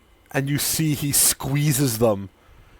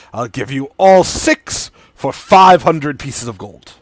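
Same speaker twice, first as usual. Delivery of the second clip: louder, higher salesman patter for the strange merchant; merchant NPC voice; 3 semitones higher, 9 dB louder than usual. Acted. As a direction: sell it like an eager shady trader.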